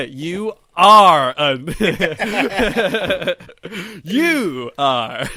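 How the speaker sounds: slow, very loud, laughing chant of "You are"; mock incantation. Host answering cohost's dare with mock incantation, loud laughing chant. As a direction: boom it like a spell, breaking into laughter. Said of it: "You gonna try any of those".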